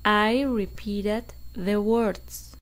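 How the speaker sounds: very slow, each word spaced for repeating; slow model sentence. Shown as a demonstration. Teacher dictating slowly for students to repeat. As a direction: read it slowly so students can echo every word. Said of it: "Repitan después de mí".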